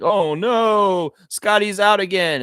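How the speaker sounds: exaggerated wail of alarm; mock freak-out. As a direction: imitate fans freaking out. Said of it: "they're freaking out".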